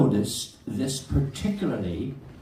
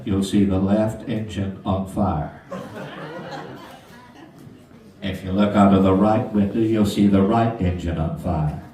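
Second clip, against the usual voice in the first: lightly voiced pilot announcement, a bit lower; deadpan captain reading the intercom message; 7 semitones lower than usual. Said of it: "It's me doing the captain's voice".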